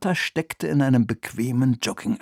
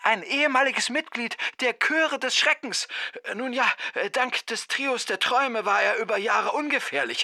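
very high, soft voice on the line; distant phone caller; 9 semitones higher, 4 dB quieter than usual. A narrator's reading. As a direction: explain urgently from the other end of the phone. (hohe, leise, ferne Telefonstimme)

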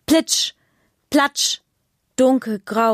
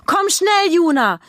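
higher and louder urgent call; 10 semitones higher, 5 dB louder than usual. Acted. Mother urging her daughter to run inside, calling. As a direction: hurry her child along. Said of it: "ruft Mama".

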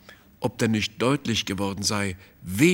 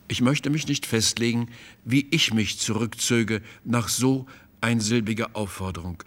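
ordinary delivery, nothing marked

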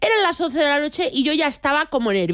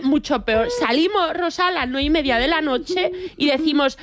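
usual speaking voice

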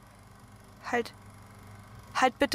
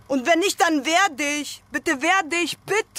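urging; 6 semitones higher, 4 dB louder, slower than usual. Acted. Fordert Partner auf, sich zu wehren.

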